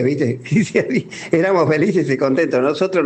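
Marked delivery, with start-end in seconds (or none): 0.5-1.3 a quick real chuckle; short laugh. Real, not acted. Caller to the radio host, laughing at his childhood memory.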